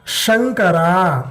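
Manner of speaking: Shankara drawn out slowly, palatal sha; teacher modelling Shankara slowly; slow demo. Shown as a demonstration.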